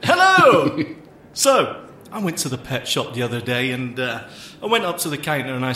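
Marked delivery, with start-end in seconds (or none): 0.0-0.8 high, loud showman's hello; big pitched-up stage hello; calling to an audience. Pretend. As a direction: hail the crowd into the mic. Showing off at the microphone.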